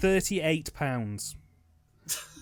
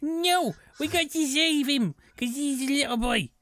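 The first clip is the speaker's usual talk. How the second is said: put-on child voice; 10 semitones higher than usual. Voicing a child in film.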